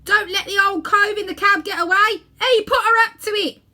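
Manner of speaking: high, loud warning shout; calling out. Acted. Someone in the crowd calling out a warning, loud and urgent. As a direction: yell over the crowd. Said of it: "someone else called out".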